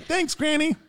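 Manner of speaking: very high mock thanks; grateful scammer. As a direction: imitate a hustler thanking granny. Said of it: "He's like".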